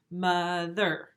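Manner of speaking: "mother" slowly split into two beats; syllable by syllable. Shown as a demonstration. Teacher counting syllables on the arm, "mother" syllable by syllable. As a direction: tap out each half of "mother" separately. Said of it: "There's two word parts".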